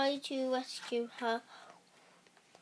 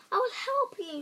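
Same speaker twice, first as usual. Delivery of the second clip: reassuring; 8 semitones higher, 4 dB louder, faster than usual. A narrator's reading. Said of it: "said the light".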